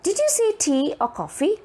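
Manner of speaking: high-pitched question, rising on "coffee"; question intonation. Shown as a demonstration. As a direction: ask it high and lift "coffee". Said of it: "It is asking a question".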